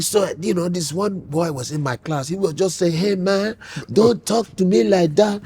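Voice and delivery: put-on Nigerian accent, telling a story; mock Nigerian father's accent; imitated Nigerian father. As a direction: play a Nigerian dad imitating African Americans. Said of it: "when he would imitate, to see Nigerians imitating African Americans".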